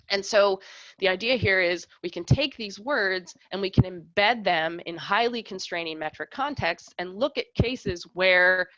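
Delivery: usual speaking voice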